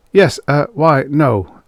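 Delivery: high, flustered sputter. Acted. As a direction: fumble for the answer, caught out. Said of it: "Engel sputtered".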